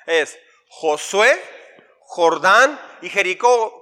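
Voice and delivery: slow, deliberate list of "Josué, Jordán y Jericó"; slow drill. Shown as a demonstration. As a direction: spell out each name slowly for the class. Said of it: "decimos así". (lento y marcado: "Josué, Jordán y Jericó")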